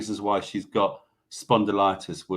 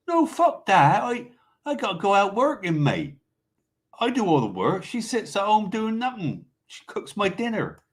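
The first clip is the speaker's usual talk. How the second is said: much higher-pitched, hammed-up Bristolian accent; Spanish in terrible Bristolian accent; 11 semitones higher than usual. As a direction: do a bad, high Bristolian put-on voice. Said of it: "Sorry if that was a terrible Bristolian accent".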